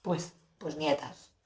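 "nietas" said slow and soft, as she misread it; "nietas" for ñetas; mispronounced word. Shown as a demonstration. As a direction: drawl "nietas" quietly, as she once read it. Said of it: "la gente pronuncia de pena".

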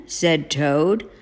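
usual speaking voice

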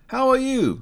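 high, brisk greeting; first businessman. A narrator's reading. One businessman greeting another, high and brisk. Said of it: "said one".

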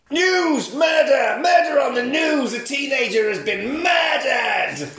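high, loud panicked shouting; hammed-up shouting. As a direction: yell the news like a frantic crier. Character shouting murder news.

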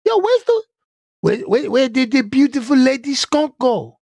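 high-pitched baffled cartoon voice, stammering; Pepe. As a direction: squeak it in falsetto, stumbling over the first words. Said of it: "he'd be like".

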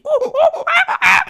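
very high, loud laughing monkey hoots; monkey whoops. Retold or imitated. Classmates cheering like monkeys, shouted hoots. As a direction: hoot like excited monkeys. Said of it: "así como micos".

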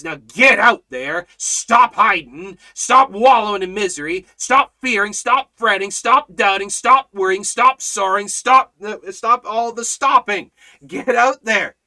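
loud, urgent preaching command; God's command. Acted. Preacher voicing God sending them out, loud. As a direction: bark the orders like a charge. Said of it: "He says".

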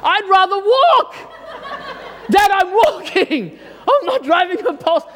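mock teen protest, laughing; laughing outrage. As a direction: laugh while playing the sulky teen.

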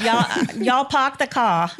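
slow Boston drawl, dropping the r in "car"; Massachusetts accent. Retold or imitated. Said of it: "but she still has the accent".

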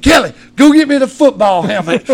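loud, high holler across the yard; yelled order. Retold or imitated. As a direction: call out urgently to the wife. Retold yelling to wife.

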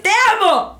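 doting owner. Retold or imitated. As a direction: gush love at the dog. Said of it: "si le dices".

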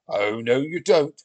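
lightly voiced Viking, loud and rising; Viking retort. Viking watching Olivia stomp, a touch of loud warning. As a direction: build up in mock warning.

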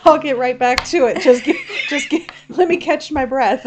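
loud, laughing put-on child voice; laughing mock kid. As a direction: play the breathless kid through laughter. Imitating the tired boy.

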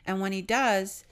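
ordinary talk, nothing marked

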